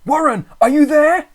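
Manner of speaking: high, loud call. A narrator's reading. Narrator calling down the phone line. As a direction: call down into the dark. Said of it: "Again I called down".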